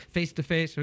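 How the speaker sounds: usual speaking voice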